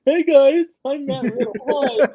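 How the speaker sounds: very high, loud, goofy-happy greeting; hammed-up cheerful entrance squeal; Matt Riddle, happy. Mocking the wrestler's happy entrance, exaggerated high-pitched cheer. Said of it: "it's the same old happy".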